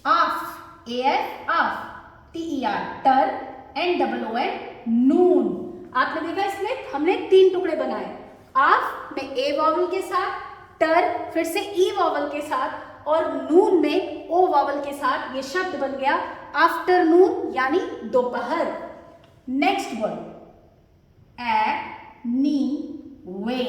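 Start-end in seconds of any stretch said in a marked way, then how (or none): 0.0-5.9 slow spelling lesson, आफ, टर, नून each set apart; read in three pieces. Shown as a demonstration. Teacher reading a word in three pieces, syllable by syllable. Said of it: "इसको हम तीन टुकड़ों में पढ़ पाएंगे". 21.4-23.6 एनी वे very slow, ए, नी, वे set apart; split syllables. Shown as a demonstration. Teacher dividing the word for pupils, split syllables.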